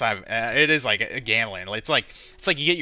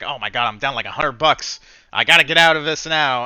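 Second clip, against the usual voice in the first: panicked self-talk; 3 semitones higher, 10 dB louder than usual. Retold or imitated. Trader reliving a losing position, building to a panicked outburst. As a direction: blurt it out faster as losses sink in. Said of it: "I'm just like".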